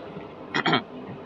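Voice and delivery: sharp throat clear. Real, not acted. Itchy throat during commentary.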